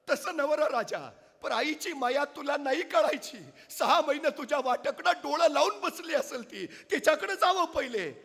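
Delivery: high, loud, half-laughing reproach; old village mother teasing the king; scolding old mother. Acted. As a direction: chide the king fondly but firmly.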